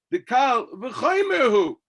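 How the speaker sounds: loud, slow emphatic cry; emphatic shout. Real, not acted. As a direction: hammer out the point slowly. Emphatic point.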